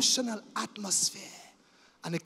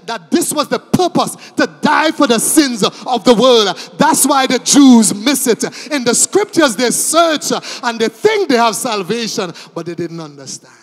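loud, driving sermon cadence; sustained loud preaching; fervent preaching; 3 semitones higher, 12 dB louder than usual. Real, not acted. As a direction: keep pressing the congregation hard. Preaching at full force.

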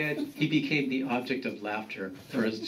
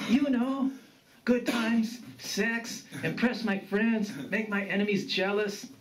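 put-on high-pitched young grandson, listing wishes; sheepish grandson; 10 semitones higher than usual. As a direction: list your wants in pieces, voice pitched high.

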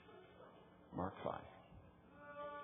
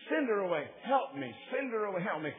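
back-and-forth pleas; 7 semitones higher, 4 dB louder than usual. Retold or imitated. Disciples and woman arguing back.

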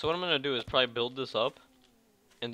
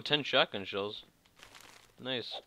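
usual speaking voice

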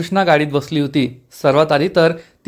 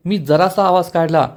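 usual speaking voice

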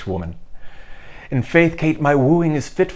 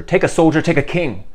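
pressing louder, higher; 5 semitones higher, 5 dB louder than usual. Acted. King urging Kate to accept him, louder and higher. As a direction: take a soldier rises loud and urgent. Henry pleads, repeating himself.

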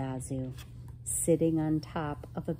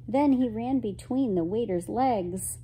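plain reading voice

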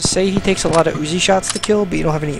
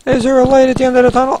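high, fast, loud and frantic; desperate gasp for an exit; scared horror game streamer; 9 semitones higher, 6 dB louder, faster than usual. Real, not acted. Fleeing in dark tunnel.

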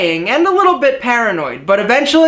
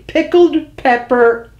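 careful slow; 3 semitones higher, slower than usual. Real, not acted. Final careful attempt.

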